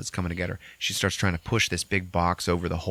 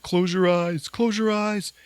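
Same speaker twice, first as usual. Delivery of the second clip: higher, louder urgent order; urgent plea; 11 semitones higher, 3 dB louder, slower than usual. Retold or imitated. James telling Lisa to shut her eyes, urgent frantic plea. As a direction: insist frantically. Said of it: "And he's like".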